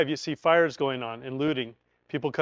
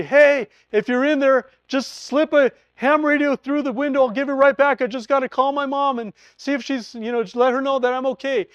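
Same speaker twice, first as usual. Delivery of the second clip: calling out; 9 semitones higher, 5 dB louder than usual. Retold or imitated. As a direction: call loudly to someone hiding inside. Calling through neighbor's window.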